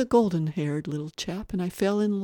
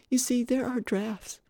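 put-on higher, earnest child voice; polite little boy; 8 semitones higher than usual. Little boy questioning the guest, politely puzzled. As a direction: speak as a small, courteous boy.

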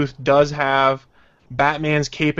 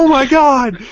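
high, loud shocked cry; 10 semitones higher, 7 dB louder, slower than usual. Retold or imitated. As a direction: gasp it out in shock. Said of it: "I'm gonna be like".

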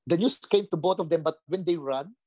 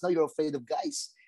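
normal talking voice

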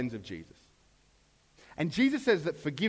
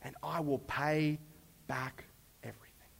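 hushed, fading plea; a whispered, slow beg on his knees; begging; 2 semitones lower, 8 dB quieter than usual. Acted. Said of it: "he begged".

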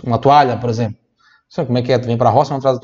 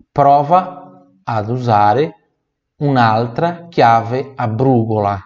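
slowed to let students copy; slow repeat; slower than usual. Shown as a demonstration. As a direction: read slowly for repetition. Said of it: "teacher repeats phrase slowly".

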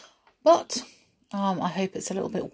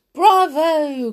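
loud, high cheer; an audience's hearty shout; cheering cry; 9 semitones higher, 10 dB louder than usual. A narrator's reading. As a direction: call out a drawn-out bravo. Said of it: "cried the audience".

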